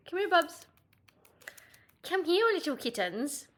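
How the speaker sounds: high, coaxing pet voice; calling the cat. Real, not acted. Pet sitter coaxing the cat to greet viewers, sing-song.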